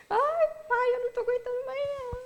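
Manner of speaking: imitating her own crying call to her father; choked, tearful plea; crying to dad. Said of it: "era um chororô".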